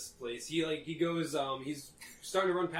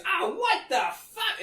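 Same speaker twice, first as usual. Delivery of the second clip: high, slow pained yell; startled angry shout; 4 dB louder, slower than usual. Acted. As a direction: cry out in shock and pain. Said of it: "He goes".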